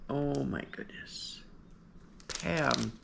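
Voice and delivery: overwhelmed, barely getting words out; tearful whisper. Real, not acted. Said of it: "I said I wasn't gonna cry".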